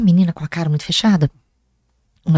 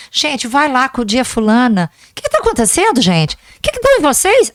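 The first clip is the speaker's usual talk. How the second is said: high-pitched, exasperated retold questioning; exasperated scolding; 4 semitones higher than usual. Teacher to her quarrelling pupils, exasperated. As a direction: climb high in mock exasperation at the class.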